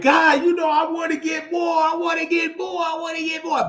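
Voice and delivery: high, shouted mock begging; pleading with God. Host acting out her impatient prayer to God, high mock pleading. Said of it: "I be kinda like".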